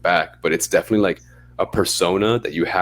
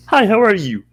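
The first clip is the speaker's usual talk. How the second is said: much higher, louder fake-bright hi; fake cheerful greeting; 8 dB louder than usual. Walking into work after a rear-ending, faking a much higher cheerful hi. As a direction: chirp it to mask a bad day. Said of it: "you have to just be like".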